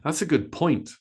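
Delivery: "point" punched high and long, "good" lighter; stress on point. Shown as a demonstration. Teacher modelling sentence stress to learners, stress on "point". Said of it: "we stress point much more".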